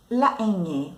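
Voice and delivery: normal talking voice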